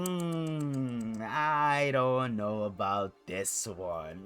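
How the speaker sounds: slow, drawn-out doubtful hum; lightly voiced Roman, slowly unsure. Roman hesitates over Sami.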